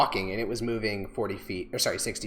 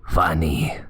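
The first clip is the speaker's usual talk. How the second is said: whisper; 6 semitones lower, 6 dB louder than usual. Acted. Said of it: "a sort of whisper into your ear".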